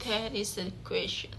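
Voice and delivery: a soft verse reading to show the meter; soft recitation. Shown as a demonstration. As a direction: read the line quietly to show its rhythm. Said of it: "The third aspect is prosody".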